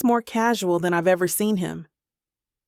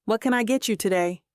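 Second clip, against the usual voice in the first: plain reading voice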